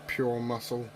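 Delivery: ordinary talk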